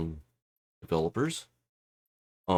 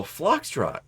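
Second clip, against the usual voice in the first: surprised question; 7 semitones higher than usual. Retold or imitated. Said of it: "he's all".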